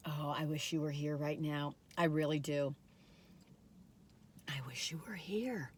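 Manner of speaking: soft whispered wish; whispering wistfully to viewers. Real, not acted. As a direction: breathe it as a secret.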